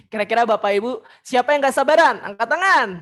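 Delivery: loud, pushy crowd call; too loud for elders. Shown as a demonstration. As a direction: call it out loudly to the room. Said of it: "Nah, jangan". (seruan terlalu kencang)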